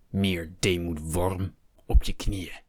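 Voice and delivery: slow, deliberate order; slow menacing command. A narrator's reading. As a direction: drag each word out to humiliate. Hector demands submission.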